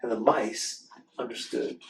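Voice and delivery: lively storybook read-aloud; reading to children. Acted. Host reading a book to children, animated storytelling. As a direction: read the picture book to the kids with expression.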